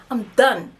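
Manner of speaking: ordinary delivery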